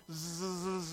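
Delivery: long imitated clipper buzz; sustained vocal zzz of clippers. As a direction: buzz on like electric hair clippers. Imitates hair clippers running.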